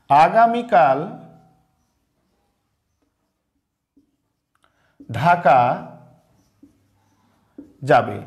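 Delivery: word by word with long gaps for writing; slow dictation. Shown as a demonstration. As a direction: dictate slowly to the class. Dictating third example slowly. (থেমে থেমে শ্রুতলিপি)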